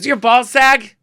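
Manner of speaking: loud, high mimicked question; imitated shouted nosy question; Joan Rivers. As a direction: blurt it brashly as the comedian. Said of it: "this unmistakable voice".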